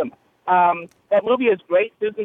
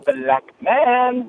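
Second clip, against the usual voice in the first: pitched-up mock exclamation, drawn out; Bernice catchphrase; 7 semitones higher than usual. Caller imitates Bernice for the host, pitched-up mock call. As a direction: caller hams up a TV character's catchphrase. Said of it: "when Bernice used to go around going".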